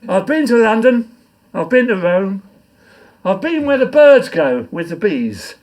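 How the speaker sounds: loud, rhythmic poem reading; poem recitation. Acted. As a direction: declaim it to the pub crowd. Recites his comic poem.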